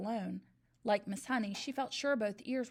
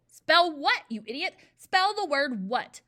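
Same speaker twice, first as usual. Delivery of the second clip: much louder, slow, bellowing demand; furious yell; 6 semitones higher, 11 dB louder than usual. A narrator's reading. Trunchbull bellows at Eric to spell the word, slow and furious. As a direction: roar the question at the boy. Headmistress screams at the pupil.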